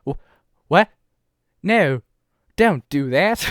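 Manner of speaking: lightly voiced French accent, rising protest; a touch of French in a flustered no. Poutine protests at her new nickname, in a light French accent. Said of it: "That was an excellent accent".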